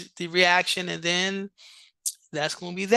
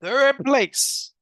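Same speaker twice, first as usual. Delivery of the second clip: louder, higher host reveal; excited announcing; 4 semitones higher, 4 dB louder than usual. Real, not acted. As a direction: announce it big. Announcing third place.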